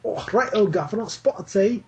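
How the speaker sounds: a deliberately bad, over-the-top English accent. Shown as a demonstration. Guest tries an English accent on cohost's dare, hammed up. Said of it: "I could do an English accent, but it'd probably be pretty bad".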